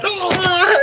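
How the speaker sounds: very high, drawn-out cheering scream; imitated excited scream. Ringside friend reacting to his mate's win, high scream. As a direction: scream high like an overjoyed mate. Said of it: "And I went".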